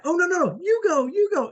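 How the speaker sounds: the former road rager now insisting you go first; eager friendly insisting. Retold or imitated. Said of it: "And he was like".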